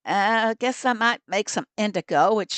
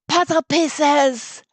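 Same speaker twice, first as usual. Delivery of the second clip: excited gasp; 7 semitones higher, 4 dB louder, much slower than usual. Real, not acted. Thrilled about puzzle pieces event.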